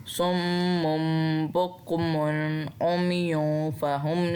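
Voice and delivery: slow, drawn-out tajweed chant; Quran recitation. Shown as a demonstration. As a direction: recite slowly, stretching the vowels. Quran recitation between explanations. (धीमी तजवीद वाली तिलावत)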